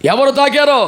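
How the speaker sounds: a mild mock shout of Jesus. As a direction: call out the question loudly. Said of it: "అని కేకలేసేస్తుంటే".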